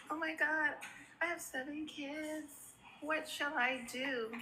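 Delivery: mocking the heartbroken mother; mock self-pity. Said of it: "sitting in the corner like".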